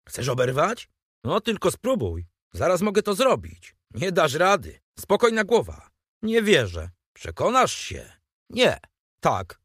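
put-on two boys squaring up, each trying to outdo the other; escalating schoolboy quarrel, half-playful threats; boyish squabble. Two boys trading dares.

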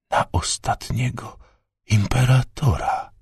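breathless whisper of shock. Acted. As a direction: whisper it, stunned. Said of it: "wyszeptał Menis". (szept w osłupieniu)

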